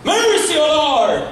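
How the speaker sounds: high, drawn-out wail for mercy; crying out. Acted. Woman crying after Jesus for mercy, wailing out. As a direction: cry out high, stretching the words. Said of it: "la mujer seguía clamando".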